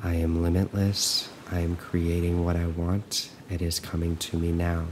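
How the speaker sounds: calm, even affirmations with long silences between; guided meditation. Acted. Coach looping affirmations for listeners to follow, calm and measured. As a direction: recite evenly, leaving room to repeat.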